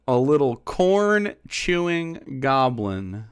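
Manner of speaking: drawn-out, slow repeat; slow savouring. Real, not acted. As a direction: savour each word slowly. Savouring the insult.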